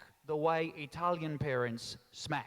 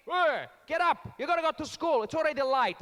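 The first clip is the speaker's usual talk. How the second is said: angry Italian dad; 8 semitones higher, 6 dB louder than usual. Retold or imitated. Italian dad yells at son to get up. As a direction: roar him out of bed. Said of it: "Straight in".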